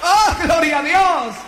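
high-pitched, exalted sermon delivery; impassioned preaching. Real, not acted. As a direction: proclaim it fervently to the congregation. Preaching at full force. (predicación ferviente, voz muy aguda)

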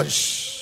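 soft hissing water noise; imitated running water. Preacher acts out water pouring down, soft hissing. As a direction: hush out the sound of falling water.

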